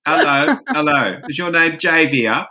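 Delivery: put-on Australian accent. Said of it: "I have to go the Australian way".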